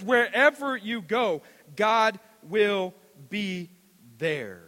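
slow, loud, each word weighed; preacher landing the verse; drawn-out proclamation. Acted. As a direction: stretch out the promise.

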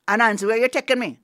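loud, fast panicked call; alarmed shout. Acted. Tied-up snake calling out to Anansi, loud and fast. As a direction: blurt it out suddenly worried.

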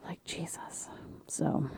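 soft whispered aside. Real, not acted. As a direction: drop to a whisper.

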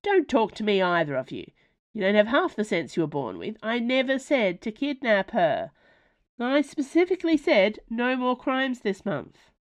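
Ma scolding her werewolf sons, loud and exasperated; scolding mother. Acted. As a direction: tear a strip off them.

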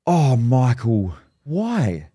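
slow, drawn-out imitation of dismay; horrified mum. Mum hears her son has quit, imitated horrified dismay. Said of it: "She was horrified".

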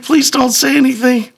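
high-pitched pleading wife. Retold or imitated. Wife begging husband to stay out of it, pleading.

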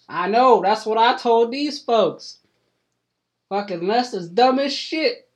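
loud, high country-bumpkin voice, scandalized; a gossipy old farmer jumping to conclusions; hillbilly farmer Cletus. Acted.

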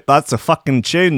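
ordinary delivery

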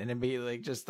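ordinary talk

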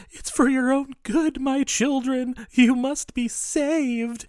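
high, very slow sing-song menace; sweetly menacing, draw it out slowly; creepy sweet old lady. Retold or imitated. Psycho old lady to her victims, slow creepy sweetness. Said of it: "She's like".

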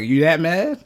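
high, loud mock disbelief; retold incredulous teasing; teasing question.

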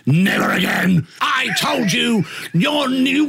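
high, hammed-up outraged telling-off; imitated furious rant, rising high; mock scolding. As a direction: scold like an exasperated colleague losing it. Acting colleague's scolding after show.

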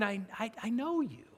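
soft, understanding "I know you"; hushed and accepting; without condemnation. Acted. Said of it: "He's not saying ... with condemnation".

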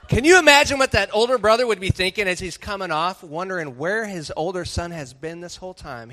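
ordinary talk

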